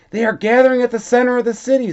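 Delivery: conductor explaining. A narrator's reading. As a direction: rattle it off quickly as the conductor. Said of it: "said the conductor".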